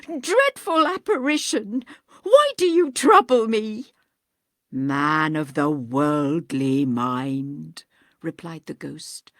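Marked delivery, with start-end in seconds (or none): 0.1-3.9 high, loud, panicked plea; frightened cry. Acted. Scrooge terrified of ghost. 4.7-7.8 low, slow, eerie ghostly voice; hollow ghost. Acted.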